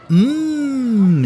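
high, far-off "Mm" of delight; a dreamy high hum over a tasty sandwich; savouring mm. Acted. Sarah enjoying her sandwich, high savouring hum. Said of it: "denkt sie".

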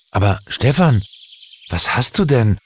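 a lightly voiced puzzled friend; ask, hurt and bewildered; confused and disappointed. A narrator's reading. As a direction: friend puzzled by the angry reply. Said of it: "völlig verwirrt und enttäuscht".

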